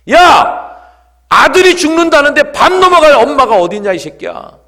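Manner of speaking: very loud, high acted scolding; mother's furious outburst. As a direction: explode at your son in anguish. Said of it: "꽉 내리치면서 한마디 하셨답니다". (아들에게 호통치는 엄마)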